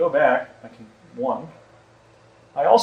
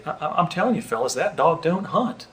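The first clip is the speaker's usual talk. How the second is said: a light Southern Clinton voice, pitched up; Bill Clinton impression; 3 semitones higher than usual. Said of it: "that was a little better".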